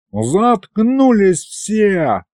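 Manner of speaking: high, drawn-out silencing yell; mock yell, long vowels; bellowed order. A narrator's reading. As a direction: stretch it out over a noisy crowd. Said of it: "заорал Аристофан".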